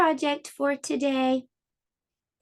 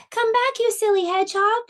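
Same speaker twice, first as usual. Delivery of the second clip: much higher calling out; calling after; 9 semitones higher, 3 dB louder than usual. Acted. Lisa running after Hedgie, calling out much higher. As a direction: call after him across the field. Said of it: "she called".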